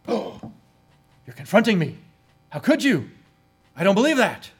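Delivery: high, loud, offended protest; indignant outburst. Acted. A churchgoer hurt by rebuke, shouting back indignantly. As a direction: cry out as if wrongly accused. Acted angry defensive reply.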